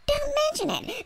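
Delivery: high, hushed, sing-song mock modesty; coyly begging nobody to bring it up; mock coy humblebrag. Mocking a star who says don't mention it. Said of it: "so you go".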